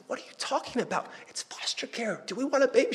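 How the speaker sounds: high, whispered disbelief as his wife; wife's incredulous whisper. Retold or imitated. Wife whispers back to husband about foster baby, incredulous high whisper. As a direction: hiss it in disbelief, playing the wife. Wife answers, voiced in retelling.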